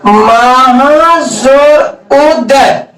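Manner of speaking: very slow, drawn-out dictation of the example; teacher spelling it out syllable by syllable. Shown as a demonstration. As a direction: stretch each sound of "महाजोर उदय" out slowly for the class. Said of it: "teacher dictates the example".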